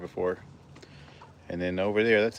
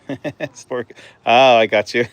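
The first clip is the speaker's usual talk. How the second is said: laughing and surprised; 4 semitones higher, 10 dB louder, slower than usual. Real, not acted. Playful startle with a chicken.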